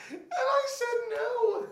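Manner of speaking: high, loud squeal of laughter; helpless laughter. Real, not acted. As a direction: barely get the words out for laughing. Breaks up at own punchline.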